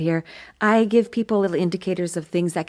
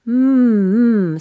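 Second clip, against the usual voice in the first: louder hum buzzing at the lips; forward hum; 4 semitones higher, 5 dB louder than usual. Shown as a demonstration. Coach showing the hum moving forward to the lips. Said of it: "feel the sound going out more towards your lips".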